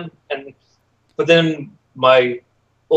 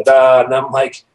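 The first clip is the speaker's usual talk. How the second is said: usual speaking voice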